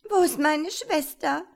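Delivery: high, loud distressed cry; tearful question. Acted. Girl asks after her vanished sister, tearful and high. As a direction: ask in tearful alarm. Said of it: "fragte Wehmut weinend".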